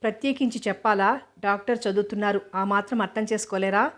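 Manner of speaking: ordinary delivery, nothing marked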